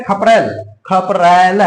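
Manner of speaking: खपरेल said slowly twice, ending एल held; teacher says खपरेल slowly to show the एल suffix; slow spoken demo. Shown as a demonstration. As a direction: repeat खपरेल slowly, leaning on एल. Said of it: "उच्चारण आप करके देखिए".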